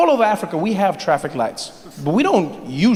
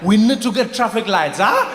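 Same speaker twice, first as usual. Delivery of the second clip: African guy calling out; 3 semitones higher than usual. Retold or imitated. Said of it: "the guy was just like".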